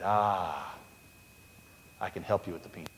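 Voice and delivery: God kindly granting Carver's request. Retold or imitated. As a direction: answer low and kind as God.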